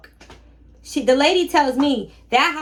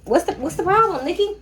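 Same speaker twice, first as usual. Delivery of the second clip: exasperated, pitched much higher; higher, incredulous "what's the problem, Nikki?"; 8 semitones higher than usual. Retold or imitated. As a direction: ask it again in disbelief, voice climbing high. Repeats question, much more exasperated.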